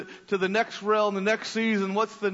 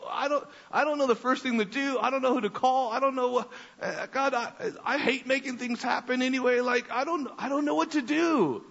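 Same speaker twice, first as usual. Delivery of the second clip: whiny, higher-pitched fretting; mock-anxious rant, pitched up; complaining rant; 5 semitones higher than usual. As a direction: pour out worried complaints to God. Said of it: "I kinda start complaining a little bit".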